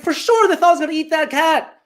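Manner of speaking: high-pitched comic alarm; alarmed realization. Retold or imitated. Realizing what the locals thought of him, comically alarmed. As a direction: push it way up high, mock-aghast.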